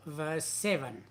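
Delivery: ordinary talk, nothing marked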